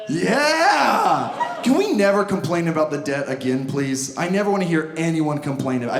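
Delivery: ordinary talk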